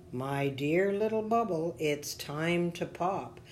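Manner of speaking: ordinary delivery, nothing marked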